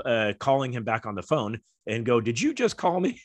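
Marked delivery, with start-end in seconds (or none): 2.2-3.2 incredulous. Retold or imitated. Incredulous callback to coach.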